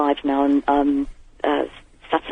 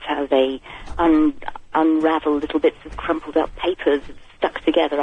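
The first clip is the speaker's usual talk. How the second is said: ordinary talk, nothing marked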